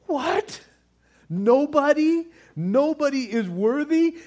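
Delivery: high, incredulous cry; shocked as if he can't believe it; stunned disbelief. Retold or imitated. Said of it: "he's just like".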